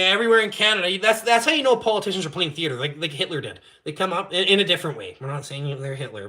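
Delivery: normal talking voice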